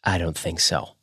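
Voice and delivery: hushed, menacing; soft, scary refusal. A narrator's reading. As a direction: keep it quiet and threatening. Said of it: "he said in a soft, scary voice".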